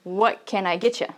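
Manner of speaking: What can I get you rattled off quickly, all linked; quick native rhythm, no gaps between words; fast and flowing. Shown as a demonstration. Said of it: "It's like a song".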